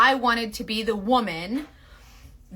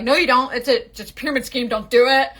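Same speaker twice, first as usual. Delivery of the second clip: retold protest; 2 semitones higher, 5 dB louder than usual. Retells warning friends off the makeup.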